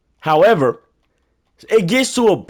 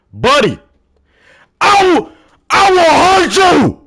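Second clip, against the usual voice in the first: furious shout; 13 semitones higher, 7 dB louder, slower than usual. Real, not acted. Threatening coworker who blamed him.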